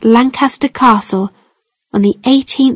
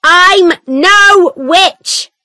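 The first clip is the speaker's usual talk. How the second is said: very slow, much higher shouted cry; shouting denial; 8 semitones higher, much slower than usual. A narrator's reading. Mother pulled to the floor by guards, shouting. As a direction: wail the denial as she's dragged down. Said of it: "my mother shouted".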